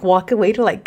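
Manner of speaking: normal talking voice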